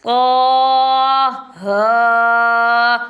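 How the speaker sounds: long drawn qaaf-alif and haa, recited loud; held letter names, chanted; Quran recitation drawn out. Shown as a demonstration. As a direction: recite each letter stretched, tajweed style. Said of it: "ایک ایک الف کی مقدار کھینچ کر ادا کریں گے".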